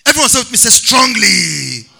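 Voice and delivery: loud, high-pitched sermon delivery; rousing pulpit cry to the congregation; full-force preaching. Real, not acted. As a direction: preach it loud, stretching the key words.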